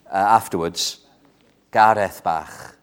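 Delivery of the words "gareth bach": put-on gently, like an old Welsh lady; elderly lady's Welsh. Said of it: "That's what we say in Welsh".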